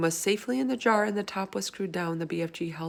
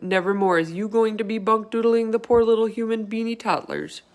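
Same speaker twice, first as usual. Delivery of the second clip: loud, raised declaration; vehement cry; 4 semitones higher, 6 dB louder than usual. Acted. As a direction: vow it at the dream in the jar. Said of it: "he cried".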